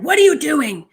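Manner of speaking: retold yell. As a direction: fire it at them like a scolding teacher. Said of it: "He yelled at them".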